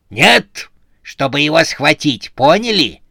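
loud, high, emphatic scolding; angry correction. A narrator's reading. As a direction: snap the order at slow underlings. Boss angrily corrects her subordinates.

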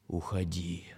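low, soft, dry statue voice; quiet dry warning, like stone. Acted. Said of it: "Тихим, сухим голосом статуя сказала".

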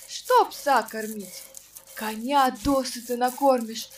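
ordinary delivery, nothing marked

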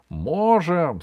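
loud, firm echoed reply; gnome's brothers. Acted. Brothers back up the gnome, loud determined call. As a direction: call it out with sturdy resolve. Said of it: "сказали его братья".

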